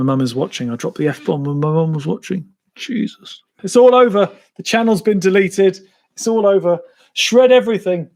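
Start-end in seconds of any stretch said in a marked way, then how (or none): 2.7-3.4 whispered, breathless Jesus; hushed mock horror; horrified gasp. Real, not acted. Realising mum is watching. 3.6-6.8 higher and louder, hammed-up alarm; playful mock despair; mock panic. Mock panic, mum watching. 7.1-8.1 louder, higher hammed-up order; mock alarm; mock command. Mock panic continues.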